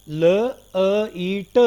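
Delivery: ल, अ, ई, ट each said as a separate sound. Shown as a demonstration. Teacher showing students the separate sounds in लाइट, ल, अ, ई, ट sounded out one by one. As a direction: break लाइट into ल... अ... ई... ट with gaps. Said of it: "अगर ये sound भी अलग-अलग दें".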